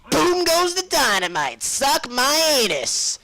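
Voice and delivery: high, drawn-out triumphant shout; gloating yell. Real, not acted. Gamer celebrating a nasty last shot, gloating yell. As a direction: crow loudly over the kill. Gloating over a game kill.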